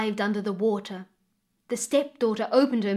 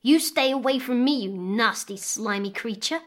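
hissed warning; 2 semitones higher, 3 dB louder than usual. A narrator's reading. Girl warns off the baby crocodile, mildly venomous. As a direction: warn it off with mild acted venom. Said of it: "hissed the girl".

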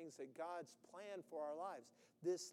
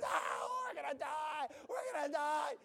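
loud, much higher wail of doom; mock panic; 7 semitones higher, 6 dB louder than usual. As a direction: shriek like the boat is going under. Disciples panicking in the boat.

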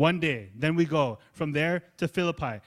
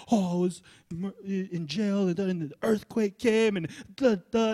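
dramatic jailer testimony; 7 semitones higher than usual. Retold or imitated. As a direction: sell the earthquake drama. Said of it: "the jailer would come up and be like".

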